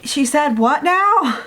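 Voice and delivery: slow, high-pitched retold disbelief; acted-out "excuse me?" astonishment; mock incredulity. Retold shocked reply to a confession.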